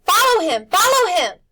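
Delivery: high, loud repeated command; urgent shout. A narrator's reading. Old woman shouting for the boys to follow the cat, urgent. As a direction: drive them after the cat. Griffin urging them after cat.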